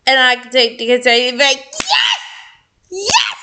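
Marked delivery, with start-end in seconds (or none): 1.4-3.3 excited yes. Real, not acted. Excited outburst mid reading.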